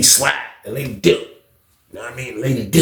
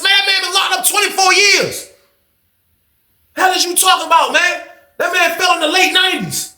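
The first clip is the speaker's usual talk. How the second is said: incredulous; 13 semitones higher than usual. Retold or imitated.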